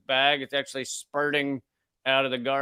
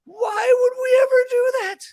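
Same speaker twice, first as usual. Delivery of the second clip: much higher, loud cracking cry; squeaky crying shout; 6 dB louder than usual. Shown as a demonstration. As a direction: wail it like outraged despair. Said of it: "it should be squeaky".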